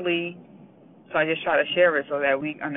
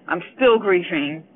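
louder, pushing through real grief; "still" pressed louder as the grief breaks through; 3 semitones higher, 7 dB louder than usual. Real, not acted. Mother telling listeners she still grieves, louder and emotional. Correcting tense, grief rising.

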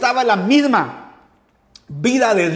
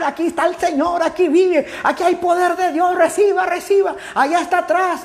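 high-pitched mock preacher's cry; imitating a shouting preacher; 8 semitones higher than usual. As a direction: call it out like a revival preacher, pitch held high. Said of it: "empezó el hombre a gritar".

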